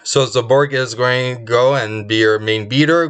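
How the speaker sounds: ordinary talk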